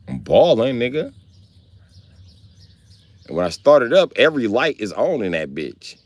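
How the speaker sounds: normal talking voice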